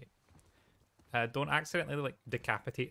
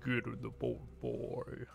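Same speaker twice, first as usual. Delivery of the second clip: lightly voiced Kratos; 4 semitones lower, 4 dB quieter than usual. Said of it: "If I had that voice".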